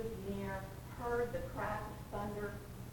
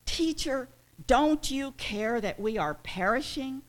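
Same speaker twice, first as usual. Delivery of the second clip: much louder, higher, pleading cry; desperate shout; 6 semitones higher, 12 dB louder than usual. Retold or imitated. Disciples waking their teacher in the storm, desperate shout. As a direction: cry out to him in panic. Disciples wake Jesus in terror.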